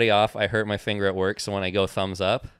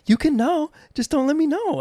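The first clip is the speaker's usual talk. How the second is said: high, far put-on voice of his wife; squeaky voice quoting his wife; imitating wife; 4 dB louder than usual. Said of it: "she's like".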